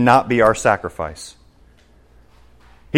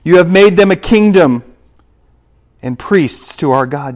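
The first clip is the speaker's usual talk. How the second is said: louder proclaiming take; preaching scripture; 5 semitones higher, 6 dB louder than usual. Acted. Preacher proclaims the verse to his congregation, loud. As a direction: ring it out over the church.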